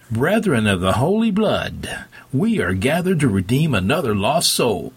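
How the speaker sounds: high, ringing preacher's proclamation; soaring preacher. A narrator's reading. Preacher addresses his gathered flock, voice soaring. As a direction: let the voice soar over the congregation. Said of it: "and his voice soared".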